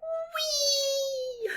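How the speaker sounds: long high held whee; gleeful whee. A narrator's reading. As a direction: squeal down the slide. Sliding off swing.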